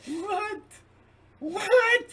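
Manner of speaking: very high, loud startled yelp; squeaky astonished double take; Kramer-style shock. Retold or imitated. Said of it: "I'm imitating Kramer there".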